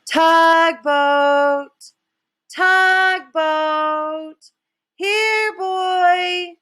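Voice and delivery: high, loud call for the dog. A narrator's reading. Father calls his lost dog from the doorway, loud. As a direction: sing out the dog's name. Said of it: "father is standing in the doorway yelling".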